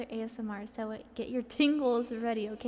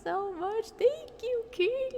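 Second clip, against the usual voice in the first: excited; 10 semitones higher, slower than usual. Real, not acted. Streamer thanking a gifter.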